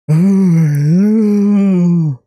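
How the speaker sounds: high, loud groaning yawn; loud long yawn. Real, not acted. Long loud yawning sigh.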